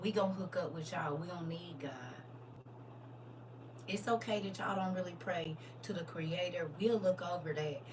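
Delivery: hushed sly voice. Acted. Voicing Jerusalem bargaining.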